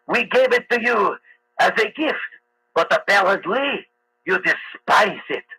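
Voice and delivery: put-on movie French accent, with drawn-out phrases; mock French accent. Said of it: "I just do the accent like in movies".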